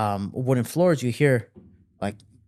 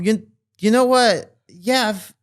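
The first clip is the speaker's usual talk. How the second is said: higher-pitched imitation of a dad opening up; acted, hesitant confession in a raised pitch; confiding dad; 8 semitones higher, 5 dB louder than usual. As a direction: haltingly confide as a worn-out dad. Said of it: "he starts confiding to me".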